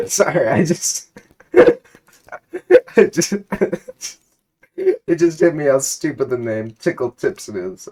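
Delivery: can't hold the giggles in; laughing. Real, not acted. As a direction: reader cracks up over the silly name, laughing. Breaks down laughing mid-reading.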